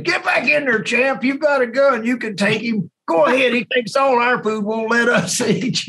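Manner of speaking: high, squeaky cartoon bird voice egging him on; mock raven voice. Friend voicing the ravens to tease him, high squeaky mock bird voice. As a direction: squeak it like a cheeky bird cheering him to fight. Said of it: "They were saying".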